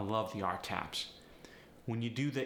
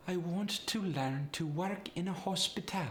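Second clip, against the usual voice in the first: higher Polish accent, even syllable stress; 8 semitones higher than usual. Shown as a demonstration. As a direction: Polish accent with slight upward inflection. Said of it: "what added to that Polish sound".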